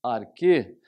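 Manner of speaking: arquê again with a closed ê, loud. Shown as a demonstration. Teacher repeating arquê with closed ê for students. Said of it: "mas esse E é sempre fechado".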